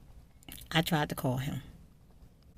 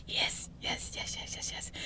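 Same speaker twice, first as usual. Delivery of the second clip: whispered yes; 11 dB quieter than usual. Retold or imitated. Delighted at his return.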